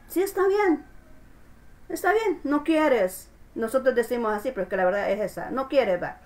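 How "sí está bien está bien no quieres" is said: much higher, resigned voice of God letting you go; God's voice. Acted. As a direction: let them go, shrugging it off. God answering the refusing believer. (voz de Dios, resignada y aguda)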